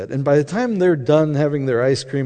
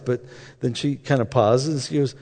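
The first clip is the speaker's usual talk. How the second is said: usual speaking voice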